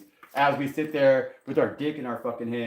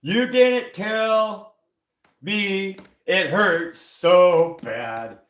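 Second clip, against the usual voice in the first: slow, drawn-out mocking whine, higher and louder; 6 semitones higher, 5 dB louder, much slower than usual. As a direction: stretch it out like a sulky complaint. Mocking a complaining person.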